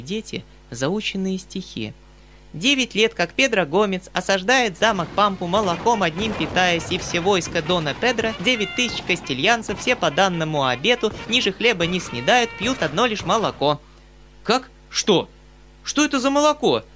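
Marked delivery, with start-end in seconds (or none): 2.5-13.8 put-on small, even, clear child voice, much higher, no pauses; child reciting verse. A narrator's reading. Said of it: "маленьким, ровным и ясным голосом, без запятых и без точек". 14.4-16.8 much higher, baffled cry; astonished exclaim. A narrator's reading. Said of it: "вскричал дядя, смотря на меня в изумлении".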